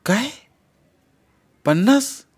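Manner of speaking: high, loud startled काय? पन्नास?; shocked disbelief. Acted. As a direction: she can't believe the number. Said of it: "चांगला धक्काच बसला तिला". (धक्का बसलेला, अविश्वासाने विचारलेला प्रश्न)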